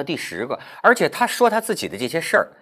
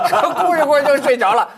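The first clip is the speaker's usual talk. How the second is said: higher, amused, laughing through the quote; laughing retelling; 5 semitones higher, 5 dB louder than usual. Retold or imitated. Host retells masseuse falling asleep crying, laughing higher. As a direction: break into laughter as you retell it.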